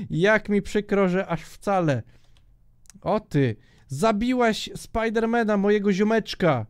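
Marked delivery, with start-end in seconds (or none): none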